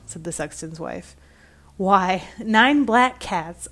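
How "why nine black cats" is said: dramatic. A narrator's reading. Dramatic reveal to wife.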